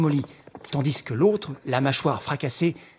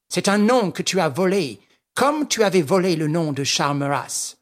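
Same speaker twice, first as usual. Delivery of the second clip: sneering Englishman, higher and mocking; Sholmès taunting Lupin, higher, sneering; 5 semitones higher, 3 dB louder than usual. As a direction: jeer at Lupin with scorn. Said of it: "L'Anglais ricana".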